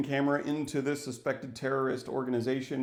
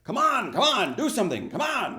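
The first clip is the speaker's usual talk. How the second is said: higher, louder "come on", pleading with the characters; frustrated urging; 9 semitones higher, 4 dB louder than usual. Retold or imitated. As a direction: shake them awake with frustrated yells. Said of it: "grab people and shake them like".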